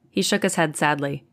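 ordinary delivery

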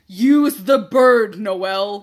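loud, drawn-out exasperated order; exasperated retort. Retold or imitated. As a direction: stretch it out loudly, fed up. Retells fed-up half-asleep reply to sister.

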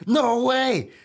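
high, loud laughing disbelief; shocked disbelief. Retold or imitated. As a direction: yelp out in stunned delight.

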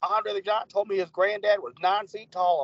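mocking old storyteller. Podcaster mocks a boastful wrestling podcast host, lightly hammed-up. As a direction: lightly ham up a windbag boasting old stories. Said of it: "I could tell he was fucking full of shit".

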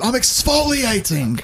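high, drawn-out character imitation; comic film quote. Quoting a funny film to bandmates, high drawn-out mimicry. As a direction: put on a slow, high-pitched movie voice.

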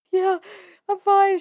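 high, squeaky-pitched fake "fine"; retold freaked-out reply. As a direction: imitate insisting you're fine while panicking. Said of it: "I'm freaking out".

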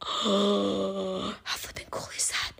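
breathless, slow whispered wonder after a gasp; awed whisper. Real, not acted. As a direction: whisper it as if overwhelmed. Awed reaction to the verse.

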